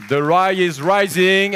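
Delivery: high, loud, slow "The rye is rising" with the stress on "rising"; shouted slogan. Real, not acted. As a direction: bellow "The rye is rising" like a rallying cry.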